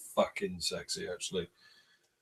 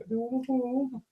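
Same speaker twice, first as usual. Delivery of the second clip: imitated protest; 12 semitones higher than usual. Imitating a friend refusing to leave, high mock wail. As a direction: mimic a reluctant plea, pitched way up. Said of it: "He went".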